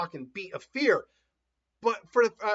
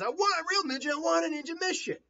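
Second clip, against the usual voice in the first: high-pitched insistent whining; pleading, high character voice; whiny demand; 10 semitones higher than usual. Acted. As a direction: complain like a pestering kid. Acting Naruto demanding a mission.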